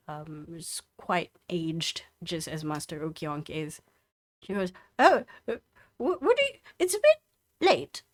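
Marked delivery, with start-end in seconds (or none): 5.0-8.0 high, flustered dwarf granny; flustered old woman. Acted. Old dwarf woman to late visitor, high and flustered.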